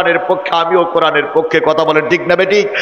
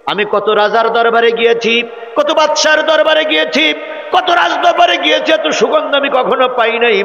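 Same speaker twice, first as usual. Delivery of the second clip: amazed son; 9 semitones higher than usual. Acted. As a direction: marvel at the perfume, voice rising. Son marvels at scent.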